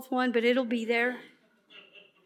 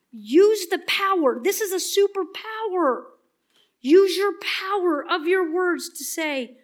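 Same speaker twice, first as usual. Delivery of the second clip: usual speaking voice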